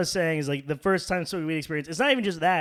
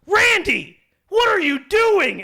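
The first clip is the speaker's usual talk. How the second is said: high, loud, drawn-out mock shriek; scolding mom; 10 dB louder, slower than usual. Friend's mom catching teens smoking, shrill scolding. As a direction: imitate a shocked mother, pitched way up. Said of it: "his mom walks in and goes".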